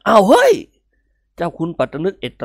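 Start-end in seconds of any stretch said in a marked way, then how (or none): 0.1-0.5 sudden loud, high "hey!"; startled outburst. Acted. Uncle blurts out in shock at the boys, loud and high.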